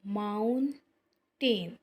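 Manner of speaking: Mountain very slow, two stretched syllables. Shown as a demonstration. Teacher showing two tongue movements, mountain very slowly divided. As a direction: pull mountain apart into two long beats. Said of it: "उसने दो दफ़ा हरकत किया है".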